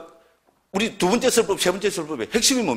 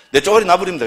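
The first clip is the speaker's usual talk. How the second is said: 쪼가리 said louder and faster through a laugh; laughing punchline; 3 semitones higher, 7 dB louder, faster than usual. Shown as a demonstration. Monk repeats the Gyeongsang word to the audience, laughing and louder. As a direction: toss the line out laughing, quick and loud. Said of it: "경상도 뭐 쪼가리 이러면 됩니다".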